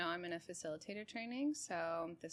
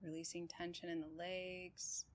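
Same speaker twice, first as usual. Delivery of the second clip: calm, even meditation guide with long pauses; unhurried body-scan voice, settled and steady; guided meditation; 2 semitones lower, 5 dB quieter than usual. Real, not acted. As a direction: guide them gently through breath and body, leaving space between phrases.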